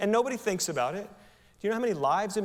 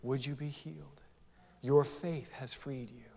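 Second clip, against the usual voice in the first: tender hush of Jesus blessing; soft whisper; 3 semitones lower, 7 dB quieter than usual. Acted. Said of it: "And Jesus said".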